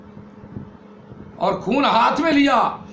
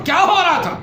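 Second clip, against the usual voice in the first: loud sharp demand, higher; angry demand; 8 semitones higher, 6 dB louder than usual. Acted. Brother demanding of his sisters, angry loud question. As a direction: bark the question at them.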